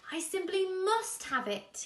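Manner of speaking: high, loud longing plea; wistful cry. A narrator's reading. Bruce pleads for the pine cone, high and loud. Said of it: "he wistfully cried".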